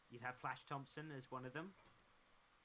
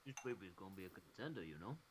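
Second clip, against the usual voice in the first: Stallone mumble; 8 semitones lower than usual. Pretend. Stallone voice, low.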